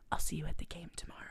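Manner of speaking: fast breathy parting whisper; quick whisper. Retold or imitated. Olivia whispers a goodbye and runs off. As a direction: whisper it and dash off.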